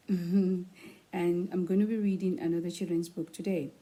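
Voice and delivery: usual speaking voice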